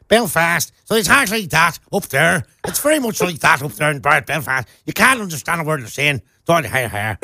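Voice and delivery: strong Northern Irish accent; Belfast accent. Shown as a demonstration. Co-host showing the different accent up north, Belfast accent. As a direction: put on a thick Belfast twang. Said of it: "Then up north, in the north of Ireland, it's very different".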